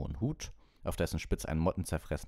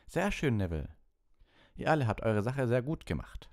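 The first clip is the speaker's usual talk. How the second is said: higher, lively teacher voice; pleased teacher praising; 7 semitones higher than usual. Acted. Lupin awards Gryffindor points, pleased and animated. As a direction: hand out praise and points to the class.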